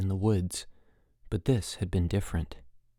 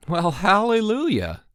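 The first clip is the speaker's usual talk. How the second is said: loud, high mock rejoicing; sarcastic; 10 semitones higher, 5 dB louder than usual. A narrator's reading. Chip hears Miller is a problem, sarcastic. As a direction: exclaim it with exasperated sarcasm. Exasperated counselor's sarcastic reply.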